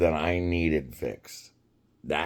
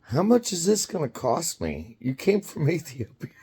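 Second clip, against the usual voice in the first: teasing; 10 semitones higher than usual. Retold or imitated. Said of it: "And I go".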